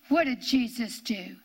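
normal talking voice

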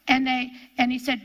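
usual speaking voice

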